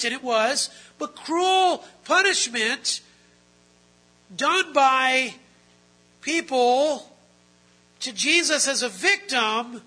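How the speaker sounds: loud, high, impassioned sermon; full-force preaching. Real, not acted. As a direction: hammer each phrase out to the congregation.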